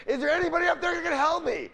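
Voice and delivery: high, loud desperate call upward; yelling up for help. Acted. Man hanging on to the ledge, yelling up for help. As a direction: yell to whoever might be up there. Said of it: "finally he yells up".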